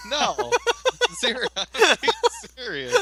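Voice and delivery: high, loud, helpless laughter through words; real laughter breaking up the words; laughing hard. Real, not acted. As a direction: crack up while protesting. Cracks up while claiming to be serious.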